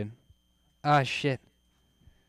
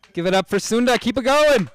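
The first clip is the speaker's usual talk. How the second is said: loud, high call to the crowd; hyping the crowd; 10 semitones higher, 9 dB louder than usual. Real, not acted. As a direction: whip up the applause. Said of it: "host hyping crowd".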